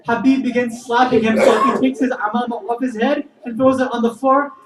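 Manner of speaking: loud, high, impassioned narration; full-force preaching. A narrator's reading. Preacher tells congregation of the companion's grief, full-force preaching. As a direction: preach it at full emotional pitch.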